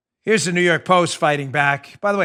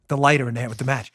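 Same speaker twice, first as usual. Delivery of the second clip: usual speaking voice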